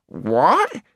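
high gnome exclamation; high, rising astonishment; astonished cry. A narrator's reading. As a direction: cry out in disbelief as a little creature. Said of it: "cried the gnome".

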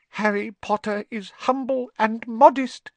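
high squeaky elf voice full of awe; high adoring elf voice, loud; reverent house-elf. A narrator's reading. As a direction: speak of your hero with wide-eyed reverence. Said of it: "said Dobby reverently".

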